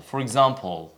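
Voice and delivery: ordinary delivery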